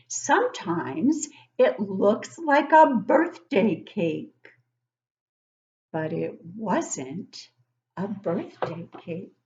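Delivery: slow, drawn-out storybook reading; reading to children. Acted. Teacher reading a picture book to young kids, slow and storybook-like. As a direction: read it slowly to the class, savouring each line.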